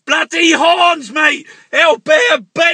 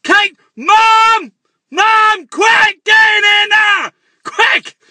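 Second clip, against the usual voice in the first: urgent shout to Mom; calling out; 5 semitones higher, slower than usual. Acted. Ranter shouting for his mum to come in, urgent yell. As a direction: yell for her to come quick.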